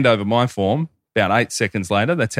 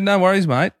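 high, chirpy no-worries; upbeat easygoing answer; breezy reply; 10 semitones higher than usual. Retold or imitated. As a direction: toss it off brightly and high. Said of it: "I said".

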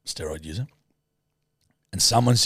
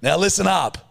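mock bossy call; 9 semitones higher than usual. To a co-host before a character game, mock-bossy shout. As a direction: bark for attention, hammed-up. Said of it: "I got a few characters for you".

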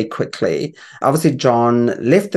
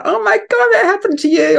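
much higher, squealing with surprise; excited squeal. Retold or imitated. Co-host reliving watching the video, excited squeal. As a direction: shriek it in delighted disbelief. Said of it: "So I was like".

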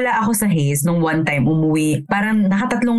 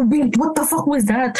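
retold shock; 5 semitones higher than usual. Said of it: "Sabi ko".

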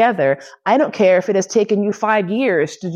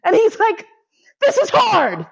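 much higher, squeaking through laughter; laughing retelling. Real, not acted. Retelling Steve's excuse, high and helpless with laughter. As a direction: quote him while you can't stop laughing.

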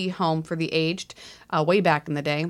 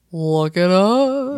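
drawn-out command; 2 semitones higher, 8 dB louder, much slower than usual. Real, not acted. Teasing husband.

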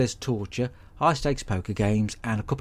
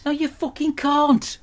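furious shout; slower than usual. Acted. Refusing Randall the choc ices with a slow, high furious shout. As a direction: drag out the refusal in a screech.

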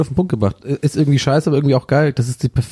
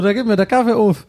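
stifled giggles; 9 semitones higher than usual. Real, not acted. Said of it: "Ich hör auf zu lachen".

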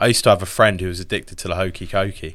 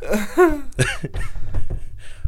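laughing. Real, not acted. Laughing after joke.

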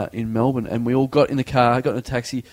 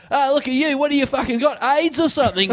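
high mocking drunk jeer; jeering passerby; 10 semitones higher than usual. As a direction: sneer at the bloodied guy. Passersby mocking bloody man.

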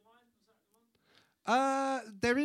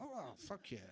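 much softer, laughing under the breath; conspiratorial glee, kept quiet; sly aside; 14 dB quieter than usual. Retold or imitated. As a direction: guest gleefully agreeing to babysit, softly. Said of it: "I'm like".

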